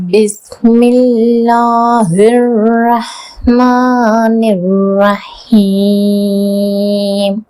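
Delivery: slow, drawn-out Quran chanting; tajweed recitation. Shown as a demonstration. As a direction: stretch every vowel, enunciate each letter. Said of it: "எப்படி தஜ்வீதோட ஓதணும்னு".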